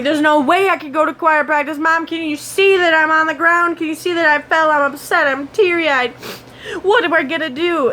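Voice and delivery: high-pitched, hammed-up pleading; upset retold protest. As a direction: plead with mom in mock distress.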